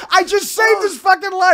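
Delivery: high, loud indignant protest; comic outrage. Real, not acted. Comic who nursed his friend, comically outraged. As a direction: protest loudly at the ingratitude.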